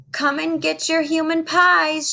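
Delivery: cheerful read-aloud shout. Acted. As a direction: call out to lure the giants in. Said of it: "shouted Jack".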